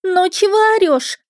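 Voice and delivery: ringing with annoyance; displeased girl. A narrator's reading. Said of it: "Девичий голос звенел недовольством". (недовольный звонкий девичий голос)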